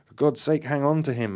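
high, loud urgent plea; desperate yell. A narrator's reading. Marion begs Joe to hold Graham, yelling. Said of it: "Marion yelled".

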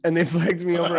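normal talking voice

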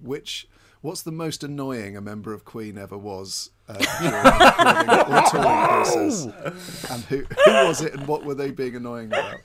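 squeaky high laugh breaking through the question. Pretend.

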